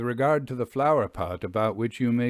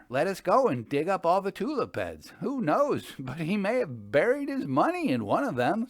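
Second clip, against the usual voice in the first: higher, lively friend voice with a laugh; Jasper, eager and amused; 7 semitones higher than usual. A narrator's reading. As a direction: propose digging up the tulips with glee. Jasper proposes digging.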